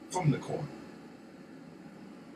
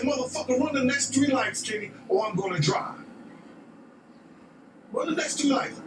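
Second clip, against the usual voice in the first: higher, urgent yelled order; retold yelling; 9 semitones higher than usual. To Kenny at the wheel, an urgent retold yell. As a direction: yell it at the driver like lives depend on it.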